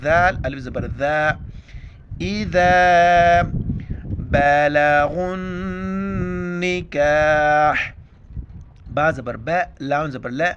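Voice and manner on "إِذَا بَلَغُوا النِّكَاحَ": slow, drawn-out melodic tilawat; Quran recitation. Acted. Teacher reciting the verse for students, slow melodic recitation. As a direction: chant each word long and ringing.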